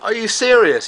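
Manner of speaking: high, amazed are you serious; stunned disbelief. Retold or imitated. Telling viewers how he reacted when his dinner was paid, amazed. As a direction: pitch it up in delighted shock. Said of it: "I was like".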